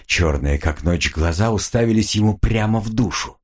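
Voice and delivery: usual speaking voice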